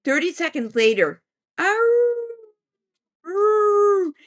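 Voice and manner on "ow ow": werewolf howl. Retold or imitated. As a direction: imitate a Halloween werewolf's howl. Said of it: "And then we hear".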